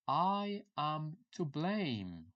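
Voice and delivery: "I am to blame" drawn out very slowly; slow model phrase. Shown as a demonstration. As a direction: stretch out each word of "I am to blame".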